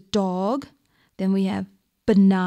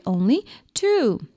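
normal talking voice

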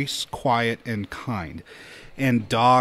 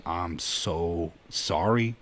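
low, soft, very slow apology; retold apology; 6 semitones lower, 5 dB quieter, much slower than usual. Son apologizing to staff for parents, slow and low. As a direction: draw out the sorry, acting contrite.

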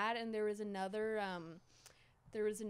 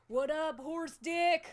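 loud, higher jeering holler; retold drunken yelling; 8 semitones higher, 6 dB louder, slower than usual. Woman re-enacting drunk friends yelling at him, loud teasing. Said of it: "yelling at him in the living room".

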